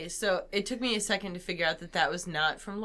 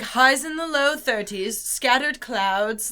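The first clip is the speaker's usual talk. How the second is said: slow, louder, higher forecaster voice; mock weather report; 5 semitones higher, 6 dB louder, slower than usual. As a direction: ham up a TV weatherman, drawn out. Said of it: "It was, like".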